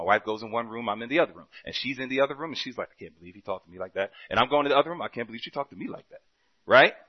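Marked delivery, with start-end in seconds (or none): 2.9-4.1 muttered grumble. Retold or imitated. 5.0-6.2 muttered grumble. Retold or imitated.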